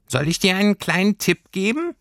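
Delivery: sehr hohes Stimmchen; hilfsbereites Pelztierchen. A narrator's reading. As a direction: biete als kleines Fabelwesen einen Rat an. Tierchen bietet Lilli Rat an. (hohe Tierchenstimme)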